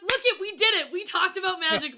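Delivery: high, loud mock triumph; excited nerd cheer. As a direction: cheer like nerds who just pulled it off. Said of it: "It's literally like".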